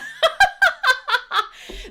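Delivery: very loud, high cackle; laughing. Real, not acted. Host welcoming a newcomer to the family, loud cackling. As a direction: burst out laughing helplessly.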